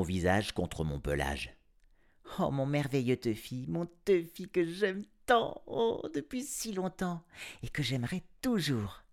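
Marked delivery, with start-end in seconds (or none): 2.4-9.0 put-on girl crooning tenderly to her cat. A narrator's reading.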